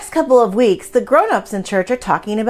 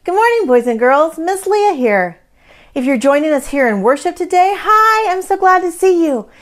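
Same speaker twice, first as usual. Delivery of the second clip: earnest upbeat school lecture; 4 semitones higher, 4 dB louder than usual. Acted. Children's time greeting to kids.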